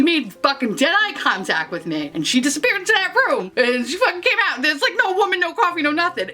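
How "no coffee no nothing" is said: ordinary talk, nothing marked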